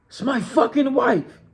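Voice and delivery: husband shouting at the intruder, outraged. Retold or imitated. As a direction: fire it back in outrage. Husband shouts back at John.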